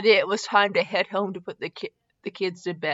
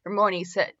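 ordinary delivery, nothing marked

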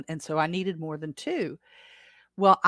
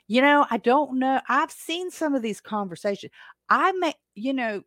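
animated; 8 semitones higher, 6 dB louder than usual. Real, not acted. Laughing self-deprecation.

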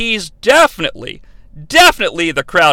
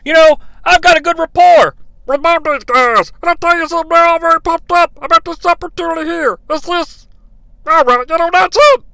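higher, held-nose underwater radio voice; pinching nose; 11 semitones higher, 3 dB louder than usual. Retold or imitated. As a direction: pinch the nose like a bad mic. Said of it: "'cause I'm holding my nose to, to simulate that".

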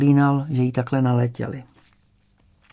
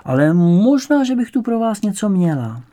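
put-on high, louder old-lady musing; 8 semitones higher, 5 dB louder than usual. A narrator's reading. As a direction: muse aloud high-pitched, as if just remembering. Old lady muses, may have something.

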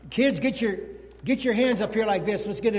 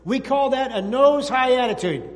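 put-on louder, higher call to the kids; louder emphatic lesson voice; emphatic teaching; 5 semitones higher, 7 dB louder than usual. Shown as a demonstration. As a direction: drive it home to the children. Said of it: "teaching plane nose demo to kids".

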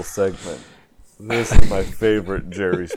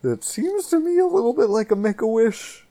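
high voice; 13 semitones higher than usual. Acted. Mock sad news delivery.

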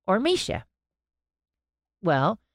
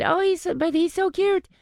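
much higher cooing gush; gushing over the bear; 13 semitones higher than usual. Retold or imitated. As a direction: coo like over a puppy. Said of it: "So she said".

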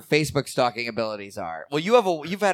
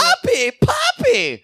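very high, squeaky calling out; 5 dB louder, much slower than usual. Pretend.

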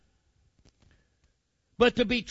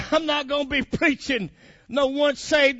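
usual speaking voice

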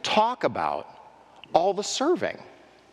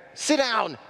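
mock scolding; 5 semitones higher than usual. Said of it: "pretend you're Jesus".

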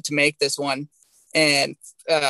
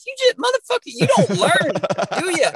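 exasperated mock scolding; 12 semitones higher, 4 dB louder than usual. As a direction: needle him like he never learns. Mocking a stubborn friend.

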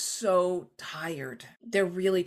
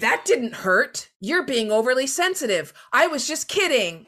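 loud, higher dismissive brush-off; defensive denial; 6 semitones higher, 7 dB louder than usual. Retold or imitated. Person who kicked a coworker, defensive and loud. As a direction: brush off the complaint loudly. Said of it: "you don't suddenly say".

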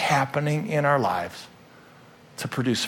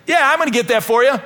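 much higher and louder, gushing; overeager promise; 11 semitones higher, 6 dB louder, faster than usual. Retold or imitated. Congregant promising coffee but never moving, hammed-up eager. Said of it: "Somebody else could say".

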